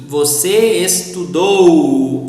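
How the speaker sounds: "estudou" slowly, with a long, strong final "dou"; stress on last syllable. Shown as a demonstration. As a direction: lean hard on the "dou" of "estudou". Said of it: "always stress on the last syllable".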